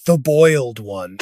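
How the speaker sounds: low, slow, eerie title read; deep, slow horror announcer; ominous title announcement. Acted.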